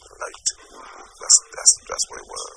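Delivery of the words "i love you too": whispered and softer, hardly any voice; whispered I love you too. Retold or imitated. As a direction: breathe it like a goodbye. Last words to dying friend.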